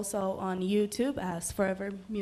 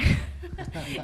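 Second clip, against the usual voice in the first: a short high burst of laughter; real laugh at her own story; 9 semitones higher than usual. Real, not acted. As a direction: laugh at the memory.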